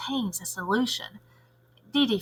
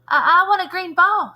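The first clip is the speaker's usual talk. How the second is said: put-on higher, louder little girl asking; a kid pleading for the green ball; eager kid voice; 9 semitones higher, 6 dB louder than usual. Dee Dee asking Lewis the art teacher for a ball, higher and eager.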